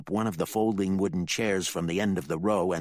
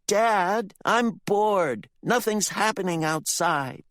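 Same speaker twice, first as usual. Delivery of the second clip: put-on much higher whining kid; bored boy; 14 semitones higher, 5 dB louder, slower than usual. Ricky complains to his dad, whiny.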